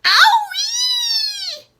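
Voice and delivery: high, loud yowl of pain; shrill pained yowl. A narrator's reading. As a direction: howl it out like a scalded cat. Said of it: "Grandma yowled".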